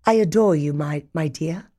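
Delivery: put-on British accent, lovestruck; lightly voiced British suitor; mock British boyfriend. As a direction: play a British boyfriend declaring love. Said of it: "want a British boyfriend to say to us".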